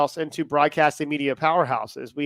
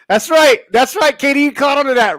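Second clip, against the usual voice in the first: excited; 8 semitones higher, 7 dB louder than usual. Real, not acted. Joking excited callout to Katie.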